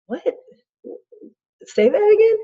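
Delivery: high, questioning reaction; puzzled parent. Retold or imitated. Parent can't understand whining kid, puzzled. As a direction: pitch jumps up in disbelief at the end.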